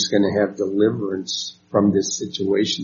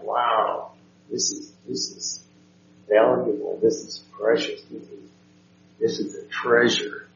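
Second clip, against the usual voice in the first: hushed wonder; 8 dB quieter than usual. Acted. Voicing the psalmist's delight in God's word.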